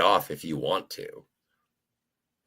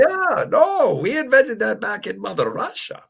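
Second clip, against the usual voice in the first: much higher mock Russian voice; Russian accent; 13 semitones higher, 3 dB louder than usual. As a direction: ham up a Russian claim. Joke claiming Russian invention.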